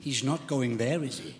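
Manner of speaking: low, soft, intimate sermon voice; quiet heartfelt preaching; hushed preaching. Acted. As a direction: draw the church in close, softly.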